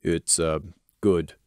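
blank. Acted. Crimson judges the toffee, low and blank. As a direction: say it with no feeling. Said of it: "he added blankly".

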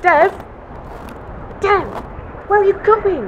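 high, loud alarmed call after him. Acted. Pilot calling after Dev walking away, alarmed. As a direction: call out, startled he is leaving.

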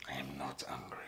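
low, hushed denial, almost whispered; suppressed anger. Acted. Josh denying anger to Laura, low suppressed voice. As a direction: hold the anger down under a whisper. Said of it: "how dangerous your anger can be".

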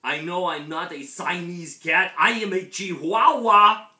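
hammed-up Spanish accent, building to loud and high. A narrator's reading. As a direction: put on a Spanish accent and build to a big proclamation. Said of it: "using his very best Spanish accent".

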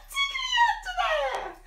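very high playful squeak; high squeaky voice. Acted. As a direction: squeak it like a tickle. Tickling rhyme punchline.

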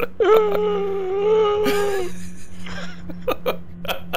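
high squealing laughter. Real, not acted. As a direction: break into a shrieking laugh. Laughing at friend's crash.

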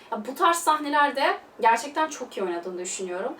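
usual speaking voice

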